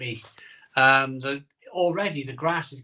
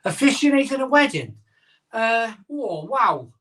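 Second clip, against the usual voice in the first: a delighted, startled question; surprised; 8 semitones higher, 4 dB louder than usual. Real, not acted. To the viewer asking him to officiate, high surprised. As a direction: pitch jumps high in amazed disbelief.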